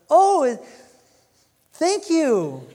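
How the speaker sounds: high, loud, laughing thanks; hammed-up grateful man; mock grateful paralytic. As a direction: play the healed man, overjoyed and giggly. Paralytic voiced thanking Jesus.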